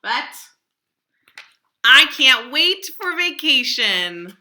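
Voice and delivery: loud, high, slow gleeful cry. Acted. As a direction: burst out with relief and glee. Joke ending, teacher's glee.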